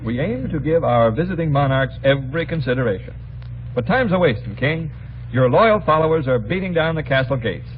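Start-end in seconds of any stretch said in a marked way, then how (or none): none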